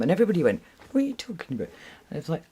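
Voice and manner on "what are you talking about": baffled classmates. Retold or imitated.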